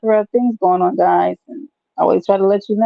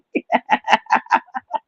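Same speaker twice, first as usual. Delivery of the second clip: high giggle; 6 semitones higher than usual. Real, not acted. Laughs at the memory.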